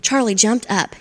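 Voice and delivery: ordinary delivery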